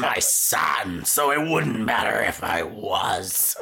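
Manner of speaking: Nick Nolte impression. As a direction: say it low and dragged out. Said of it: "impersonating his voice".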